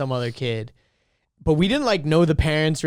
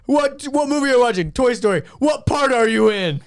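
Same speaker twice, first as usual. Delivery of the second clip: high, hammed-up eager questioning; goofy cop voice asking questions; mock police officer; 8 semitones higher, 3 dB louder than usual. Friend playing police asking about the movie, mock-eager.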